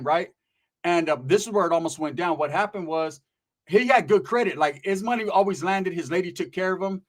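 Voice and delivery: normal talking voice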